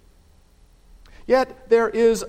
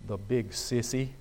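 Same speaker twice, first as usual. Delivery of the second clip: low, soft, shaky muttered jab; a hushed trembling grumble from the dark; 6 semitones lower, 9 dB quieter than usual. Acted. Father voiced in the dark, low shaky punchline. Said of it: "a shaky voice saying".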